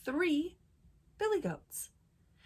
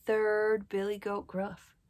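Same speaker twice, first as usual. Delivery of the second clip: ordinary delivery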